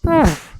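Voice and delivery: high, punchy mock "poof" as he zips past; poof sound effect. Describing a speeding rider to a friend, a high mock poof. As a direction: pop the word out like something vanishing.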